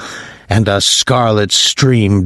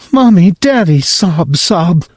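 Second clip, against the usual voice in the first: high child's voice with mock sobs; crying little boy; 7 semitones higher than usual. Whipping boy reunited with his parents, high and mock-sobbing. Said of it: "Whipping boy".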